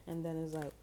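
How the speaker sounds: ordinary talk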